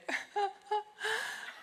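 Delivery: high, half-crying laugh from being moved; a laugh that breaks toward tears; teary laugh. Real, not acted. Presenter overcome while praising her friend, teary laugh.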